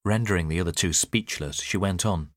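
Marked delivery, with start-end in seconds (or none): none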